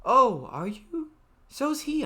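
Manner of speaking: a hint of a curious teacher; lightly voiced teacher. Voices adult character in dialogue.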